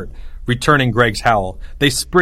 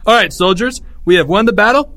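higher, louder address to troops; rallying commander; 9 semitones higher, 5 dB louder than usual. A narrator's reading. General Greg addressing his soldiers, rallying commander. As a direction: call the soldiers to attention.

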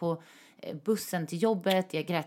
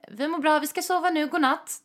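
higher, sugary sweet reply; innocent reply; 9 semitones higher, 4 dB louder than usual. Retold or imitated. Texting her ex to hide where she is, higher and sweetly innocent. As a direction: sound innocent and cheerful, hiding guilt. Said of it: "Och jag bara".

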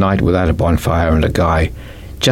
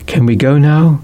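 put-on higher, eager young boy; lightly voiced eager boy; boy asking; 8 semitones higher, 3 dB louder than usual. A narrator's reading. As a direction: ask like a keen kid. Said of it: "asked the older boy".

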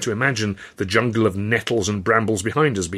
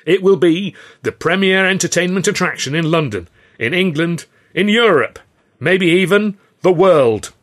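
louder and higher with each place, slowing at the end; building grand proclamation; 8 semitones higher, 7 dB louder than usual. Acted. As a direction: build it up to a messianic peak. Boss builds grand claim.

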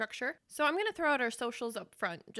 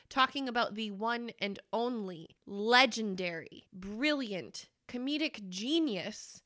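ordinary talk

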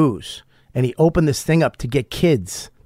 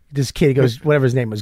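normal talking voice

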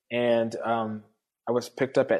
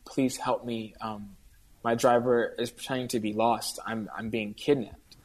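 hushed, scared phone plea, mostly quiet; a mild imitation of a frightened whispered call; retold whisper; 4 semitones lower, 7 dB quieter than usual. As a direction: keep it low like she can't be overheard. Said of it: "we hear her whispering on the phone".

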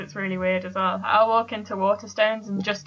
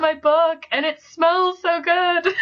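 mock gushing; 8 semitones higher, 4 dB louder, slower than usual. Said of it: "You're just like".

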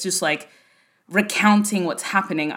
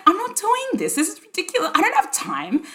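much higher, dismissive protest; mock indignant; 12 semitones higher than usual. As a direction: brush the idea off, hammed-up. Retelling refusing book deals.

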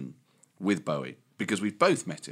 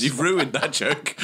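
slow, laughing mock complaint; 4 semitones higher, slower than usual. Real, not acted. Comedian tells co-host the joke is spoiled, laughing complaint. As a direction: protest through laughter, drawing it out.

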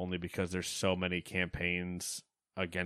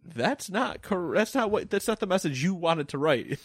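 incredulous; 9 semitones higher, 6 dB louder than usual. Real, not acted. Correcting the cartoonist, high and incredulous. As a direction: hammer the not, incredulous.

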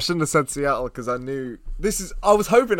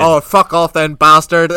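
mock angry; 8 dB louder, slower than usual. Old lady swearing at bartender.

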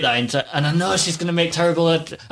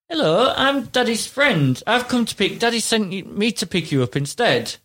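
pretend stranger; 7 semitones higher than usual. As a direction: play a stranger coaxing a small child. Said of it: "and they say".

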